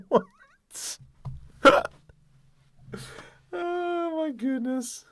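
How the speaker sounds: high, squeaky laughing disbelief. Real, not acted. Streamer cracking up while reading the chat, laughing. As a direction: say it through a high-pitched laugh.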